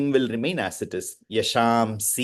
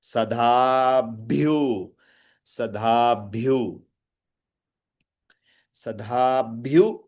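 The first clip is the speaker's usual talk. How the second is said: slow "Sadhabhyu dayada" split into separate syllables; syllabified. Shown as a demonstration. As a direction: break "Sadhabhyu" into syllables, slowly. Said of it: "Do the syllabification".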